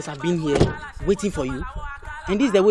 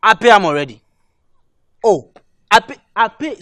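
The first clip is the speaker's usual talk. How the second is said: loud, hasty assurance; emphatic, over-keen promise; eager insistence; 12 dB louder than usual. Acted. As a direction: jump in loudly to agree. Boyfriend eagerly claims he paid.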